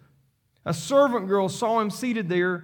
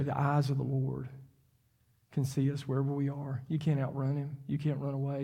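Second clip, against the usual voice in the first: much softer, low, intimate sermon voice; hushed preaching; 5 semitones lower, 8 dB quieter than usual. Real, not acted. Preacher speaking tenderly to the congregation, softer intimate preaching. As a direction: lean in and speak gently to each listener.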